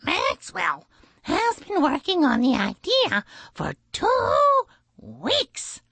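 very high, chirpy bird voice; high-pitched boastful blue jay; proud chirp. A narrator's reading. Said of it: "the blue jay chirped proudly".

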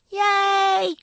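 high little cheer; tiny cheer. A narrator's reading. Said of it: "came a tiny cheer".

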